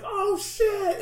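high, loud "oh shit" of fan excitement; hyped gasp. Retold or imitated. Fans reacting to the announcement, squealing with excitement. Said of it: "people are like".

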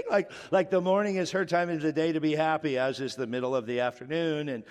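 ordinary delivery

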